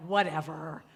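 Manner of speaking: drawn-out playful dismissal; dismissive whatever. Retold or imitated. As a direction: toss her word back comically. Mimics her catchphrase for a laugh.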